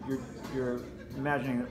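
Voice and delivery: normal talking voice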